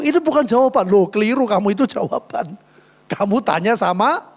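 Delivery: ordinary delivery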